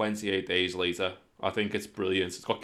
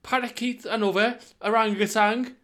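high, loud giddy rush; excited; 7 dB louder than usual. Real, not acted. Mate planning a zombie-era feast at Chester Zoo, high and giddy. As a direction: gush out the zoo plan in a squeal.

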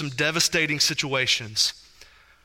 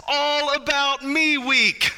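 slow, each word weighted; incredulous emphasis; 7 semitones higher, much slower than usual. Shown as a demonstration. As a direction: spell out the name in disbelief. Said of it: "they literally called it this".

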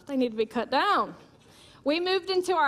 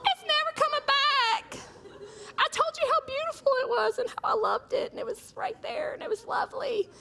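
much higher, laughing wail over the dead tree; shrill dismay; 13 semitones higher than usual. Retold or imitated. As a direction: wail at your husband, half laughing, about the dead tree. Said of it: "I was like".

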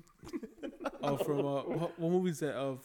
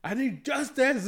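drawn-out, pitched-up dramatic plea; mock plea; 7 semitones higher, 3 dB louder, slower than usual. Friend joking about being run off the road, mock plea. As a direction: plead it slowly, hamming it up.

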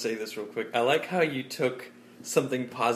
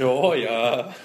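loud, hammed-up Canadian agreement; Canadian accent; 8 dB louder than usual. Brother agreeing with the Canadian bit, mock Canadian accent. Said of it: "We here in Canada".

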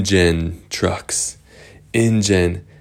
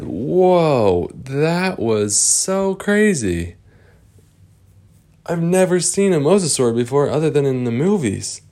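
put-on amazed kids; 7 semitones higher than usual.

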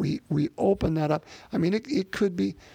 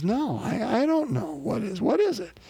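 higher-pitched, puzzled and eager; baffled city man; 6 semitones higher than usual. Retold or imitated. City man begs the cowboy for the answer, higher and baffled. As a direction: imitate a clueless, curious greenhorn. Retelling Billy's baffled reply.

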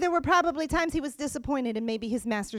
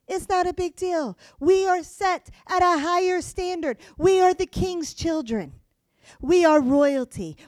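higher and louder proclamation; forceful preaching; 5 semitones higher, 6 dB louder than usual. Real, not acted. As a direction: proclaim it to the whole church.

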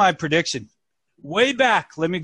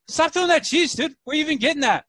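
mock yell; 11 semitones higher, 3 dB louder, faster than usual. Rival calls out in story.

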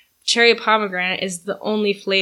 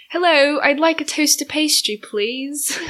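higher, put-on English accent; playful mock English accent, pitched up; 5 semitones higher than usual. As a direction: demonstrate a polite English accent. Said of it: "How do you do an English accent".

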